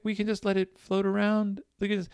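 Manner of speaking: put-on high, pleading kid voice; imitated begging. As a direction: plead with mom like an upset boy. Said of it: "begging his mom".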